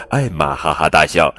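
ordinary delivery

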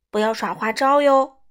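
loud call, pitch kept level; called warning. A narrator's reading. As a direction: call out a warning against tricks. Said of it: "他叫道".

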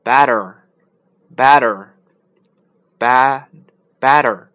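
loud, very slow American better with a flapped t; American accent. Shown as a demonstration. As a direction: drill the American better slowly. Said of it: "美国人呢".

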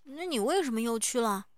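talking around a stone in the mouth; words blurred by something held in the mouth; mouth full. A narrator's reading. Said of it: "口齿不清地开口".

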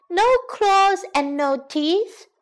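put-on high, slow, taunting girl voice; mocking girl. Girl mocking the toothless lion, high and taunting.

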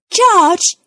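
high, loud call to George; calling brother. Acted. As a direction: call out to get his attention. Peppa calls her brother.

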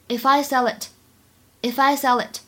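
"If I sell it" run together without gaps; linked sounds. Shown as a demonstration. Teacher modelling the joined phrase "If I sell it", linked. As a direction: link f to "I" and l to "it". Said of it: "当中可以做连读".

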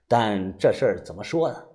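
ordinary delivery, nothing marked

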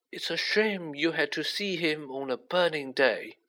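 put-on unhurried old mentor, pitched high; kindly old headmaster. A narrator's reading. Voices Dumbledore in story.

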